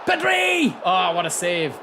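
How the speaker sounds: high, loud burst of joy; excited shout. Real, not acted. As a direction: cheer the save out loud. Excited reaction to save.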